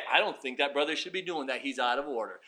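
low, hushed criticism. Acted. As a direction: voice a churchgoer's murmured objection. Voices disapproving church members.

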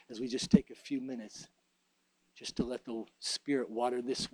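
soft, hushed closing prayer; quiet prayer. Acted. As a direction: pray softly to close.

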